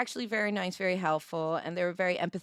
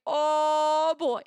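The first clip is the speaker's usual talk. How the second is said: put-on higher, louder nervous oh boy; 7 semitones higher, 5 dB louder than usual. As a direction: caught out and nervous. Realising the cop recognised her.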